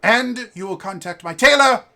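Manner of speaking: high, loud, commanding little aristocrat; imperious posh kid. Acted. Posh boy bossing the restaurant host, high and loud. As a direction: order the servant about like a lord.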